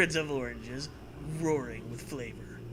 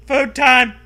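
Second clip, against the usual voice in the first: excited shout; 9 semitones higher, 16 dB louder than usual. Acted. Shouting about food.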